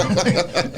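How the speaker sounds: short amused laugh; quick laugh at the memory; real laugh. Real, not acted. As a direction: let a laugh break out. Laughs after retold hospital quote.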